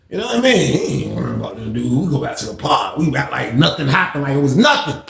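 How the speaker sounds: usual speaking voice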